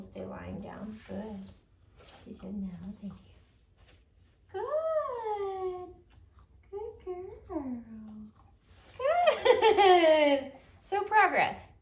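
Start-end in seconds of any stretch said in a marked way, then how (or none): 4.5-7.7 much higher, sing-song "good"; high praise voice. Real, not acted. Trainer cooing praise at the dog in a high voice. 9.0-11.7 high, loud "good" with a laugh; thrilled with her progress; laughing praise. Real, not acted. Trainer celebrating the dog's progress, laughing and loud.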